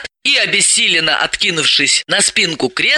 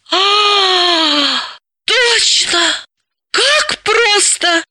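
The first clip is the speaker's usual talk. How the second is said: much higher, slow gasping exclamation; the penny drops; sudden realisation; 14 semitones higher, much slower than usual. Acted. Dalila suddenly gets the solution, gasping high. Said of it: "Далила ахнула".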